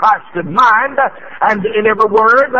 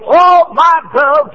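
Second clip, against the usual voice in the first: slow, high, louder oh my dove; fervent cry; 7 semitones higher, 4 dB louder, much slower than usual. Real, not acted. Preacher reading to church, a fervent high cry. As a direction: lift it up with longing.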